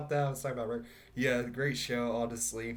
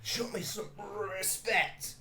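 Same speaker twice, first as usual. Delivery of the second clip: drunken, higher put-on cartoon voice; Rick; 7 semitones higher, 4 dB quieter than usual. Actor doing his drunk Rick voice to demand respect. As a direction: slur it out like a burping drunk. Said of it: "he's, like, doing Rick impressions".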